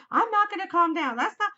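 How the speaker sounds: teen daughter yelling back in a mock outburst; angry outburst. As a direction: fire back, high and loud, refusing to calm. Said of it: "She said".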